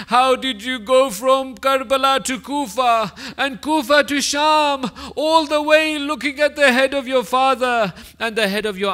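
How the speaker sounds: grieving preacher addressing the Imam, impassioned; impassioned preaching. Acted. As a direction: preach it out, building grief. Preacher's rising lament.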